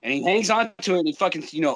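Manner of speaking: ordinary delivery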